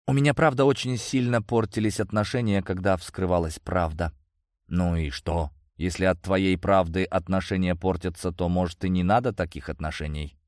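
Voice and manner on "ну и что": ordinary delivery, nothing marked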